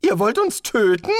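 aghast disbelief; alarmed. Acted.

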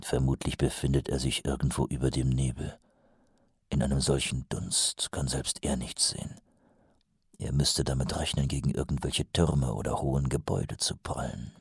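low, quiet, brooding captain; Vimes brooding. A narrator's reading. (leise grübelnder Hauptmann)

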